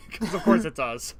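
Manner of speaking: normal talking voice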